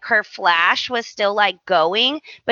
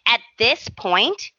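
ordinary talk

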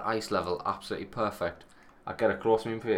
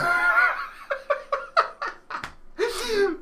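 very high wailing laugh-cry; hammed-up laughing sob; 3 dB louder than usual. A mock sob at [name]'s song, high and wailing. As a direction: break into a mock weeping laugh.

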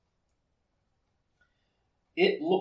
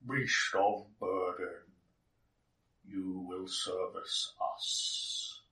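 slow, low, gurgling alien voice; wet echoing voice; 4 semitones lower, 6 dB quieter, much slower than usual. Acted. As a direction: let it ooze out slowly, low and wet. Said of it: "you hear a wet voice echoing your head".

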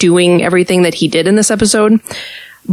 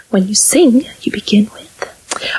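hushed Sound of Music quote; 2 semitones higher than usual. Retold or imitated. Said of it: "you quoted Sound of Music".

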